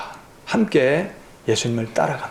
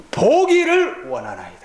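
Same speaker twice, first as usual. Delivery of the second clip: eager plea; 12 semitones higher than usual. Acted. Beggar answering Jesus.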